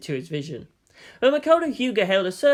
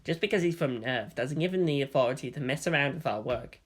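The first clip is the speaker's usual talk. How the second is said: lower, softer resentful grumble; soft muttering; 3 semitones lower, 6 dB quieter than usual. Acted. Writer complaining to colleague about the nerv man, soft mutter. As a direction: keep it under your breath, sulking. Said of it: "someone else muttered softly".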